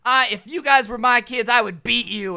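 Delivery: imitating a furious woman threatening teens; mock angry yell. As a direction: bark the threat at kids. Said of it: "flips us off and yells".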